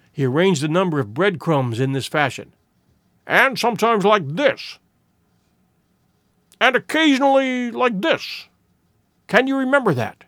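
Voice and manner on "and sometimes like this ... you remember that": raised pitch, leading questioner; eager sleuth testing a companion's memory; intent detective. A narrator's reading. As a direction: demonstrate point by point, expectantly. Voices Holmes demonstrating the tracks.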